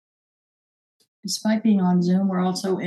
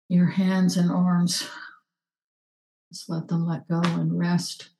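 calm grounding exercise. Acted. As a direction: settle them into the body. Grounding: feet, chair, hands.

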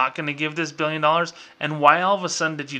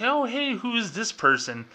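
high, bright mock greeting, pitched way up; hammed-up friendly bank teller; 9 semitones higher than usual. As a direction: play a cheery bank clerk who cares.

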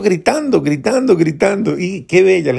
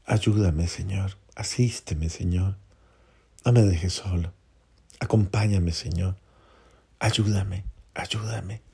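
lower, much softer repeated plea to God; quiet prayer; 5 semitones lower, 8 dB quieter than usual. Shown as a demonstration. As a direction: murmur the prayer as if alone with God, fading. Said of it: "repetirla como una ejaculatoria frecuente". (oración íntima, más baja y muy suave)

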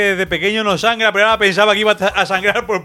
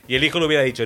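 ordinary talk, nothing marked

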